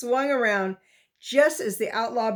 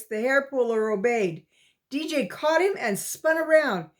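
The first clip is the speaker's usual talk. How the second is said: usual speaking voice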